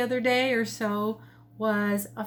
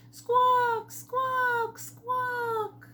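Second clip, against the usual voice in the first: high squawking bird call; pheasant squawk; 13 semitones higher, much slower than usual. A narrator's reading. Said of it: "he called out".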